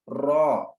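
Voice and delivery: रॉ said full and heavy, rounded mouth. Shown as a demonstration. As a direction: voice रॉ thick from the tongue edge. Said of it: "इसको रॉ मोटा अदा करना है".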